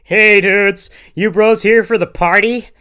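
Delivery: high, loud, jolly American bro; loud beaming Uncle Sam bro; friendly party dude. A narrator's reading. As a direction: greet them like a cheery frat guy. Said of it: "friendly man dressed up as Uncle Sam".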